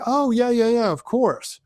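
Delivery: quick, high, offhand yes-yes-of-course; breezy polite agreement. Retold or imitated. A new acquaintance on the plane, agreeing breezily. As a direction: brush her off with an eager-sounding yes. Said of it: "I'm like".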